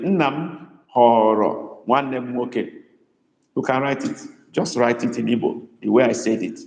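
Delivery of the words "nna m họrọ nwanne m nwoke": Nna m họrọ nwanne m nwoke said slowly, word by word; slow dictation. Shown as a demonstration. Teacher dictating to students, slow and clear. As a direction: spell it out slowly for writing. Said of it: "Write it the way I said it".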